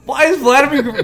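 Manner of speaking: high, loud indignant cry; outraged surprise. Acted. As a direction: yelp it in mock outrage. Outraged reaction to the joke.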